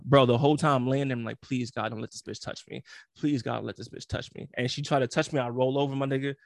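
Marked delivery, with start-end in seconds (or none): 1.4-4.5 softer, lower, pleading under his breath; desperate prayer. Retold or imitated. Lying in bed praying she won't touch him, soft and pleading. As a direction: beg God quietly.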